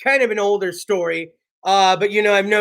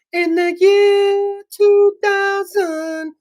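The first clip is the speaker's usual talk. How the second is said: high, slow, booming sing-song; slow high mock-dramatic chant; Conan year two thousand bit; 10 semitones higher, much slower than usual. Shown as a demonstration. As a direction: intone it like Conan's sketch. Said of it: "We'll do the co".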